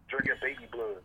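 ordinary talk

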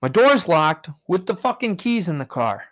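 usual speaking voice

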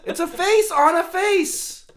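high, slow squeaky voice. Acted. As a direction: pitch it way up and drag it out. Joking about the mask.

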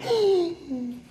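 high-pitched mock gasp of surprise; astonished gasp. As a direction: act out a sudden squeaky gasp. Reacts to unexpected deposit. (grito ahogado de sorpresa fingida)

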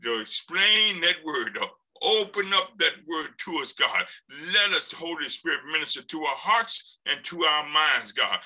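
fervent spoken prayer. Real, not acted. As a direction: pray aloud to the congregation.

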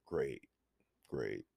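low, flat, quiet sarcasm; deadpan it sarcastically; sarcastic. Real, not acted. Sarcastic self-comment.

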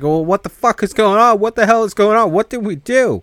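high, loud panicked shouting; panicked yelling. Retold or imitated. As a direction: yell it in a total panic. Characters freaking out, hearing screams.

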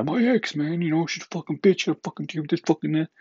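high, whiny put-on voice complaining about his ex; mocking whiny roommate. Vlogger mocks his roommate's phone rants, high and whiny. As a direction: pitch way up and whine like a sulky bro.

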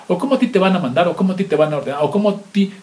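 normal talking voice

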